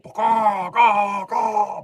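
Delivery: loud high cawing crow; crow caws. Acted. Crows cawing as they fly off, loud and high. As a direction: caw like a crow.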